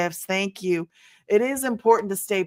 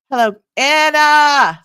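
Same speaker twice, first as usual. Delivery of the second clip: higher, much louder and slow welcome; excited greeting; 6 semitones higher, 10 dB louder, slower than usual. Real, not acted. Host warmly hails viewer Anna, loud and slow. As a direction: sing out her name in welcome.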